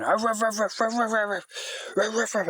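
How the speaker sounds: high mock woofs; playful retold barking; dog bark imitation. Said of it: "he was going".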